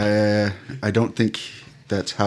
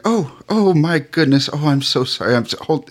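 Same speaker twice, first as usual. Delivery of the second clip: higher-pitched, gushing apology; flustered maid; 6 semitones higher, 3 dB louder than usual. Acted. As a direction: play a maid fussing over a spill. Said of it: "And she's like".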